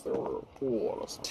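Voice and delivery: whispered repeat, breathy and hushed. Acted. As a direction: say it again under your breath.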